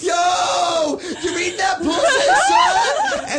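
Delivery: hammed-up laughing holler from the groomsmen. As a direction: yell it across the lobby, teasing him. Guys hollering at him.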